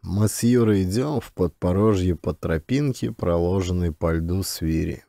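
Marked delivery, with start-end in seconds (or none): none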